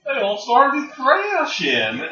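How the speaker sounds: high, loud, slow bumpkin drawl; country bumpkin. Retold or imitated. Co-host redoing the bumpkin's line from the show, high rural drawl. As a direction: holler it slowly like a country bumpkin. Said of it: "the country bumpkin".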